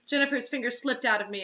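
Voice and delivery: ordinary talk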